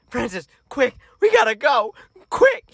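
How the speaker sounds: urgent imitation, climbing high; mock-hysterical mother, pitch rising to a squeak; hysterical mom. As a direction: imitate a panicking mom hurrying her kid. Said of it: "she's, like, hysterical".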